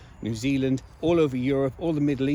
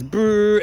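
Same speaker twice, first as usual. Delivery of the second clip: drawn-out brrr, raised and louder; imitated machine whirr; 7 semitones higher, 7 dB louder than usual. Shown as a demonstration. Speaker mimicking a machine churning out receipts, imitated whirr. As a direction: make the noise of a machine running.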